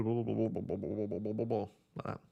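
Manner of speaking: normal talking voice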